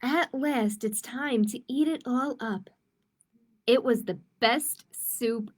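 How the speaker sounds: put-on gentle storybook read-aloud for kids; reading to children. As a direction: read it like storytime for little ones. Reading a picture book aloud.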